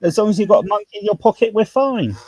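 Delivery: a hammed-up London barrow boy in Cockney; cheeky Cockney mate, high-pitched; Cockney accent. Shown as a demonstration. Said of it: "I'll speak a bit of Cockney".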